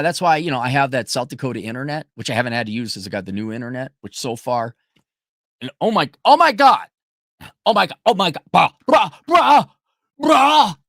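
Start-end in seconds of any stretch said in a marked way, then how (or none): 5.8-10.8 loud, high, stunned oh my God; real outrage building to loud bruh; shocked exclamations. Real, not acted. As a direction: blurt it out in disbelief. Shocked by bank problem.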